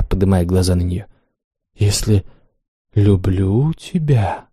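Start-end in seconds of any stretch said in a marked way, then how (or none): 1.8-4.5 «Если люблю тебя» stretched very slowly, each word long; drawn out. A narrator's reading. The mistress echoes her slave's plea, drawn out. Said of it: "протяжно повторила Ванда".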